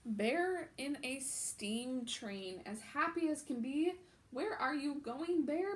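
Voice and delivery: put-on animated children's book narration; picture book read-aloud. A narrator's reading. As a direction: read it out like storytime for little ones.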